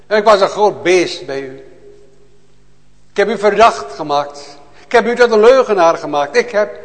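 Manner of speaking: loud, high, impassioned preaching; fiery preaching. Acted. Preacher to his congregation, a loud fervent cry. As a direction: proclaim it to the whole church with full fire.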